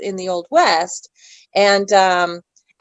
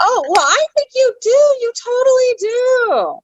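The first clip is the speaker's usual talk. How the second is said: gushing reassurance; 3 dB louder than usual. Real, not acted. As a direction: gush it very high, stretching the last words. Gushing reassurance after guest doubts herself.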